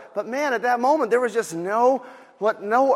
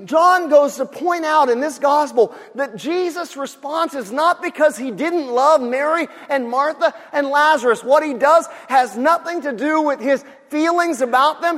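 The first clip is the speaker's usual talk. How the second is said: ordinary talk, nothing marked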